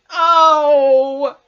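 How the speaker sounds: long, very loud "oh" of woe; despairing wail. A narrator's reading. Pooh trapped in the jar, loud despairing wail. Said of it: "a loud roaring noise of sadness and despair".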